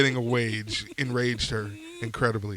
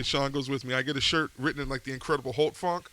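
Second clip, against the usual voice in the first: usual speaking voice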